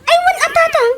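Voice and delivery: high-pitched exclamation; eager agreement. Acted. Child answering encouraging father, eager high exclamation.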